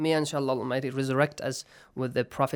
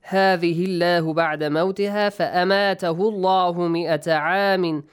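louder, flowing tajweed recitation; Quranic recitation; 2 semitones higher, 5 dB louder, faster than usual. Shown as a demonstration. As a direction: recite the verse briskly in one connected run. Recites the verse being taught.

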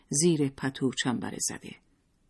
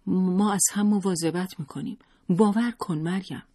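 higher, pleading with a lump in the throat; urgent plea, holding back tears; choked up; 6 semitones higher than usual. A narrator's reading. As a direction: narrate a tearful plea, lightly acted. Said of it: "لیلا با بغض گفت".

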